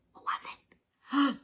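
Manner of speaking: slow hushed whisper; suspense whisper. A narrator's reading. Narrator whispers in suspense to listeners. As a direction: draw out the mystery.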